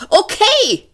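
very high, loud barked okay; barking. A narrator's reading. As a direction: bark it out sharply. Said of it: "bellte Frumfondell". (sehr hohes, lautes, gebelltes Okay)

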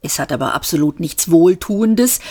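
plain reading voice

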